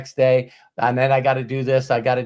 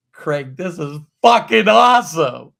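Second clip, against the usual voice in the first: louder, thrilled exclamation; excited praise; 2 semitones higher, 7 dB louder than usual. Retold or imitated. Thanking Craig for the set: loud, thrilled praise. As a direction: burst out with delight. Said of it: "And I go".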